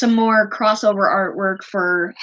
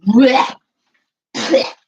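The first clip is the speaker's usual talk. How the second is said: spat-out gag of disgust; loud mock retch; disgusted blech; 5 semitones higher, 5 dB louder than usual.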